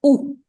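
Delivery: teacher isolates the oo sound of O U for students. Shown as a demonstration. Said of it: "creates the sound".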